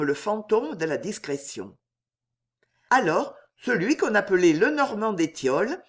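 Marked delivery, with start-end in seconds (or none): none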